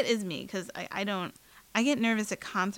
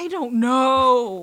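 drawn-out loud cry; 4 semitones higher, 12 dB louder, much slower than usual. Real, not acted.

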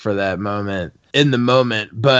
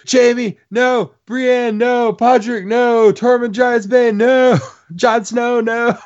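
high, pleading no after each name; panicked fan; 13 semitones higher, slower than usual. Retold or imitated. Fan watching heroes in peril, high panicked pleas. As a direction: cry out for each hero in panic. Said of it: "'Cause you're like".

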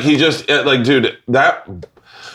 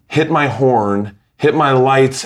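ordinary talk, nothing marked

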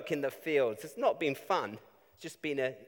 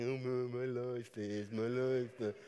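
mock whiny self-pity; lightly hammed-up grumbling; self-pitying moan. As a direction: moan like a hard-done-by grump. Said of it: "you can start thinking".

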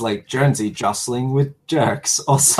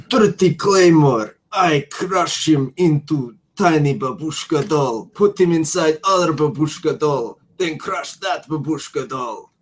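put-on Russian accent; 3 semitones higher than usual. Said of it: "it's Russian for comrade".

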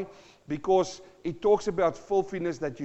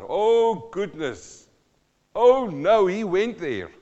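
mock shocked; 3 semitones higher, 8 dB louder, slower than usual. Real, not acted.

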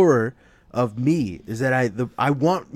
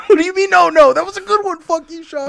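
squealed, much higher and louder; high squeaky fake indignation; mock outrage; 10 dB louder than usual. Defending his favorite show from Sean, mock shrieking.

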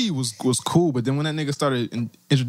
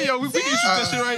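hype shout; faster than usual. Real, not acted. Hyping up the segment.

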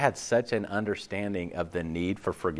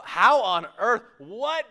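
mock shocked disbelief; 9 semitones higher, 3 dB louder than usual. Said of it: "You go".

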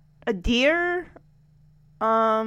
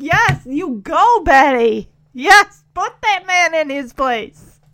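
loud, drawn-out imitation of a cheering crowd; mock cheering; 8 dB louder, slower than usual. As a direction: cheer her on loudly, retelling it. Voices the crowd cheering Betty on.

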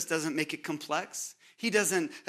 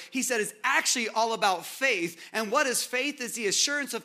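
preaching at full force; 3 semitones higher, 3 dB louder than usual. Acted. Preacher presses the point.

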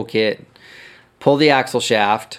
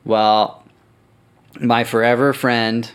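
ordinary talk, nothing marked

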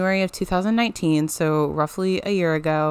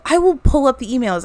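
heated; 6 semitones higher, 4 dB louder than usual. Retold or imitated. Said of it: "I'm getting so heated".

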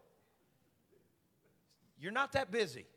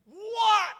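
sudden high loud startled cry; jolted awake by a blinding light; startled what; 13 dB louder than usual. Retold or imitated. Mary startled awake by the light, high cry. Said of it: "She's like".

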